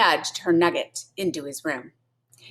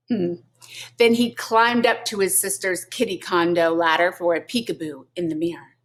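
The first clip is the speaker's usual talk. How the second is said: plain reading voice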